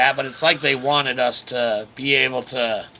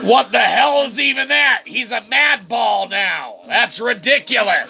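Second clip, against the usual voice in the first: outraged surprise; 7 semitones higher than usual. Real, not acted. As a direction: balk at the absurd enemy. Enemy turns into mad ball.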